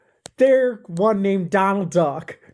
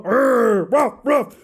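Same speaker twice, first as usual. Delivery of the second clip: dog growl and bark; 4 semitones higher, slower than usual. A narrator's reading. The dog Camel Toe growling at the visitors, barking. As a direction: growl then high imitated barks.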